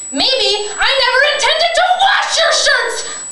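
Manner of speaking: furious outburst; angry shout. Acted. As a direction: shout it, high and loud. Outburst after pleading.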